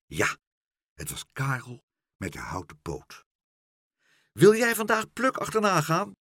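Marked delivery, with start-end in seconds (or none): 4.3-6.1 high, raised calling voice; shouting. A narrator's reading. Meneer Pen asks flying Karel to follow Pluk, shouting. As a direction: call up to someone overhead. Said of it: "schreeuwde meneer Pen".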